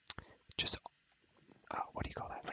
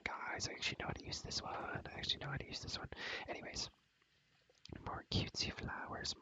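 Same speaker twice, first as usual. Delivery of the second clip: normal talking voice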